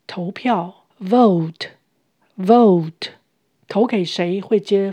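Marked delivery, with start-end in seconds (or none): 1.0-2.9 Vote said very slowly and louder with a clear v; slow loud drill. Shown as a demonstration. Teacher repeats the new word for learners, slow loud Vote. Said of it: "Vote，投票".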